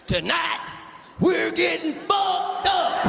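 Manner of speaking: slow, drawn-out hype, rising to a shout; party hype. Retold or imitated. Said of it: "what they said when they was leaving".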